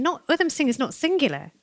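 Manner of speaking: ordinary delivery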